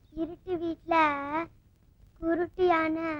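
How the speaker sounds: soft, level, halting repeat; pondering aloud. Acted. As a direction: think it over aloud, quietly. Player repeats riddle while thinking. (யோசித்தபடி மெதுவாக முணுமுணுத்தல்)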